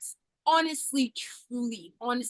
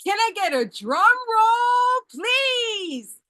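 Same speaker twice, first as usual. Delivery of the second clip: hyped announcer; 6 semitones higher, 5 dB louder, slower than usual. Pretend. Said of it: "I had to put on my announcer voice".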